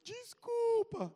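very high, drawn-out loud wail; hammed-up tearful child; mock crying apology. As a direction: bawl it like a beaten little boy. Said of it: "Aí o menino chorando".

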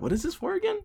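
high, soft puzzled question; off-mic confused guest. Acted. Guest unsure why they are recording, confused and off mic. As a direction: ask it faintly, away from the mic. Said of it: "not in the microphone being like".

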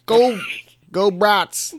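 loud, high Midwestern cheer; mock Wisconsin accent. Friend cheering like a Wisconsin local, loud and high. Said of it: "I love that accent".

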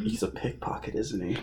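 very soft, whispered guess; hushed aside. Real, not acted. Friend guesses the plot twist, hushed aside.

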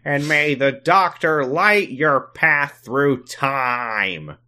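high, very slow grand blessing; Sam voice. Acted. Host closing the listeners' prayer, hammed-up character voice. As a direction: draw out each word like a solemn benediction. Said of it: "I'm so bad at this voice".

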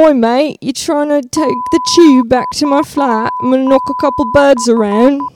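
hammed-up British slang accent; rough British accent. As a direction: shout it as a rowdy British lad. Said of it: "say that exact same thing again".

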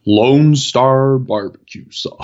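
hammed-up, stretched Southern accent; mock Southern accent. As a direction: draw out each word in a Southern accent. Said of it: "southern accent".

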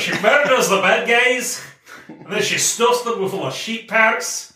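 Scottish accent. Shown as a demonstration. Retelling her superhero's deeds in a Scottish accent. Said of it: "I could say what I just said in a Scottish accent".

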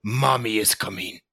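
low, menacing villain growl; lightly voiced dark villain; Steppenwolf voice. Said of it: "sounds like Steppenwolf now".

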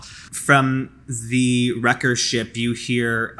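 normal talking voice

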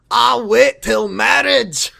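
drawn-out, booming movie-character voice; Fat Bastard. Acted. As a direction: bellow it slowly like a Shrek-style character. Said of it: "Fat Bastard voice really is just Shrek voice".

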